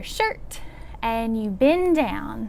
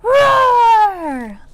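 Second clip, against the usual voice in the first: full-out high dragon roar; big loud roar; 9 semitones higher, 13 dB louder than usual. Pretend. Librarian's last dragon roar for the children, shouted. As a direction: let one long, huge roar rip. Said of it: "one more big loud roar".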